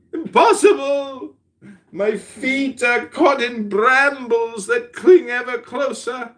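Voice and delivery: high, slow theatrical distress; hammed-up nightmare panic; panicked sleeper. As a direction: wail it as if trapped in a nightmare.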